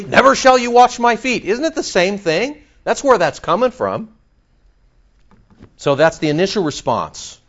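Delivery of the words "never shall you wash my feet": emphatic refusal. Retold or imitated. Quoting Peter's refusal.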